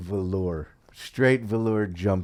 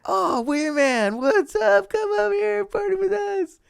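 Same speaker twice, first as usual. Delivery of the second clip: put-on party girls calling; 3 dB louder than usual. Wee Man imitating rooftop girls inviting him to party, high squeal. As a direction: mimic giddy girls luring him over. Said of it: "then they're like".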